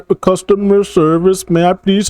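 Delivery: sing-song helpful service rep voice; mock customer service rep.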